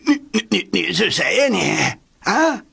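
stammering, rising high in alarm; shocked and scared. A narrator's reading. Terrified uncle stammers at the emperor, high. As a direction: stutter in fright. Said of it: "又惊又恐".

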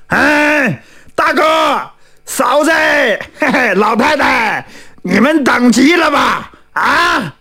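high, drawn-out hail from a distance; calling out on the run. A narrator's reading. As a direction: call out for your companions as you run up. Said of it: "边跑边喊".

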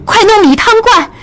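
put-on high-pitched, insistent young lady; urgent command. A narrator's reading. Lady ordering her maid to save her benefactor, urgent.

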